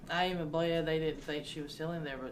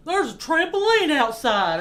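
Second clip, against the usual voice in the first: loud, high, slow country holler; very country accent; 11 semitones higher, 15 dB louder than usual. Retold or imitated. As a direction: imitate a thick country drawl, stretched and yelled. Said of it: "real country like".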